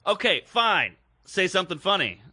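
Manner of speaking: heckler's challenge. Retold or imitated. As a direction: imitate a heckler daring the comic. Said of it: "she did the whole, like".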